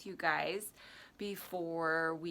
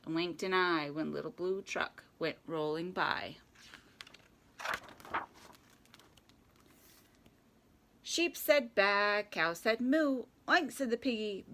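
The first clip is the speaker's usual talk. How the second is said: lively rhyming picture-book read; children's story narration. A narrator's reading. Reading Little Blue Truck to children at story time, playful narration. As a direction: read it playfully with animal sounds.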